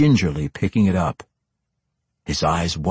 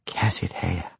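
hushed, softer utterance; whispered; 6 dB quieter than usual. A narrator's reading. Matron recognises her brother's sword, hushed. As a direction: say it under the breath, softly. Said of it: "she whispered".

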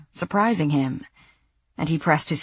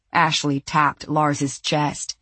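usual speaking voice

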